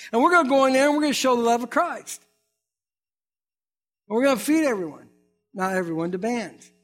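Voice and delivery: rally the church like a preacher; preaching. Acted. Pastor rallying his church for the outreach, preaching.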